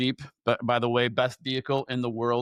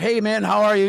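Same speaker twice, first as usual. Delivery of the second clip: bright, louder, higher hello; friendly greeting; 8 semitones higher, 7 dB louder than usual. Retold or imitated. Greeting the man in the parked car, loud and friendly. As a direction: call out a cheerful hi.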